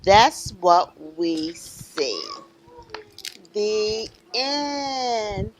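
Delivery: rhythmic list, each item lifted at the end; reading to children. Acted. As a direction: build the refrain, then draw out the ending. Reads picture book to children.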